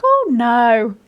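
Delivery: high, loud imitation of a kindly Australian; kind Australian accent. Doctor reassuring a struggling mother, kind Australian accent. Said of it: "in this kind Australian accent".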